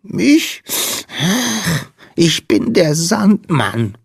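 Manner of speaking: high, slow, tearful squeak; sniffle, then introduce yourself pitifully; small sandman snivelling in a high voice; sniffling little creature. A narrator's reading. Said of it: "schniefte der Wicht".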